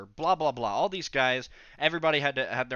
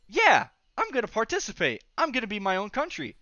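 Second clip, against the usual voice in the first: excited; 8 semitones higher, 5 dB louder than usual. Retold or imitated. Thinks idea is cool.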